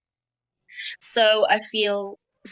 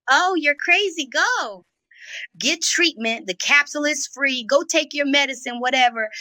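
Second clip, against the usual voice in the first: higher-pitched, retold brush-off; mock dismissal; 6 semitones higher than usual. Woman replaying how she sends her boyfriend off, dismissive. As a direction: act out waving him away as crazy.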